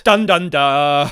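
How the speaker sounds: loud, drawn-out "dun dun dun"; hammed-up vocal cliffhanger sting; mock dramatic sting. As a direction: belt out a cheesy suspense sting, last note held long. Mimics dramatic reveal sting.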